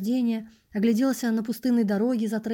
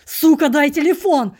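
slow, much louder and higher furious scream; savage yell; 6 semitones higher, 11 dB louder than usual. Acted. Narrator screams at the passerby for the phone, furious. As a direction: roar it in a voice not your own. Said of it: "не своим голосом заорал я".

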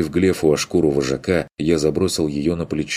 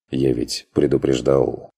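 ordinary delivery, nothing marked

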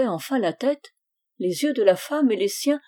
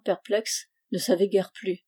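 plain reading voice

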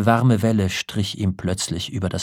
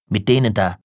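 louder, short angry burst; furious; 5 dB louder, faster than usual. A narrator's reading. Old man points at the music room, furious. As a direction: spit it out in contained rage. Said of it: "Wütend schwenkte er seinen niedern Kopf".

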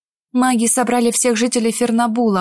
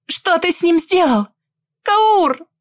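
cry out; 7 semitones higher, slower than usual. Acted. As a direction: call out in alarm. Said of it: "вскрикнула".

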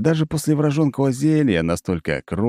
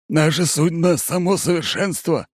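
eager impostor; 9 semitones higher than usual. A narrator's reading. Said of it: "охотно подхватывает самозванец".

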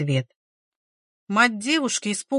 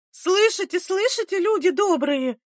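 very high-pitched public lament; wailing; 10 semitones higher than usual. A narrator's reading. Aunt calling villagers against the midwife, wailing. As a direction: wail to rouse the neighbours. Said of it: "заголосила та в ответ".

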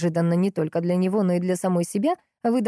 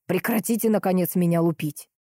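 plain reading voice

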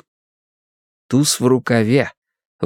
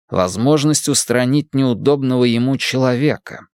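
usual speaking voice

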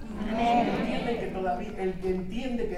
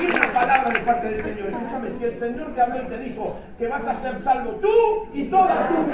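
loud, higher, driving sermon delivery; full-force preaching; 7 semitones higher, 15 dB louder than usual. Real, not acted. Pastor preaching loudly to the church about holding God's word.

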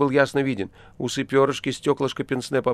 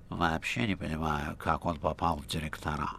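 nasal voice; 6 semitones lower, 9 dB quieter than usual. A narrator's reading. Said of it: "Рыжий гнусавил всё больше и больше".

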